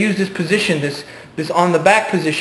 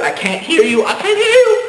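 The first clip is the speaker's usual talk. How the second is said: mock deafness. Mock blocking out voices.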